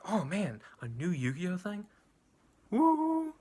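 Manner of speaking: mock-giddy, rising to a high whoop; excited fan. Fan recalling news of a new series, excited. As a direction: recreate fanboy hype, capped with a high "Woo!". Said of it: "excited, 'cause I was like".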